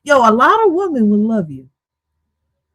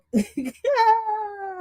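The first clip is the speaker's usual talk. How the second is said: helpless high-pitched laughter; real giggling breakdown; high laughing fit; 9 semitones higher, 7 dB quieter than usual. Real, not acted. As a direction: crack up at the screen. Cracking up at clip.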